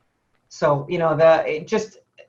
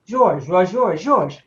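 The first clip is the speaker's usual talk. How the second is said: imitating a French accent; 5 dB louder, much slower than usual. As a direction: mimic a French woman repeating George. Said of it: "she was very heavy French accent".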